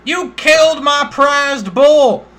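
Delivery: high, loud and slow accusation; outraged farmer. Acted. Farmer accusing the adventurer, slow, high and loud.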